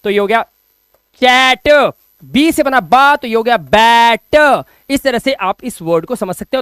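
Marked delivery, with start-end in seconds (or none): 1.2-4.6 drawn-out चैट and बैट with open ae and "tuh"; sounding out letters. Shown as a demonstration. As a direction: stretch the vowel in चैट and बैट emphatically. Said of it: "बीच में बांस फाड़ा".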